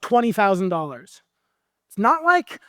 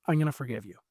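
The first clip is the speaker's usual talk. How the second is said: soft, lower, tender; gentle forgiving king; 6 semitones lower, 8 dB quieter, much faster than usual. Acted. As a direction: let the king's mercy land quietly. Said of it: "and he goes".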